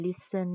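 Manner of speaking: listen with s running into en; silent T. Shown as a demonstration. Teacher explains the unvoiced letter, listen without any t sound. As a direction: say listen as LISS-en, dropping the t. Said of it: "ตัว t บ่ออกเสียง".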